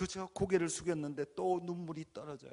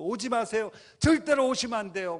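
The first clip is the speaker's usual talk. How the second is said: loud, much higher pleading cry; shouting and crying; 9 semitones higher, 12 dB louder than usual. Retold or imitated. Preacher begging Jesus not to come, shouting. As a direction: cry out in desperation. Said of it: "막 소리치면서 울기 시작했어요".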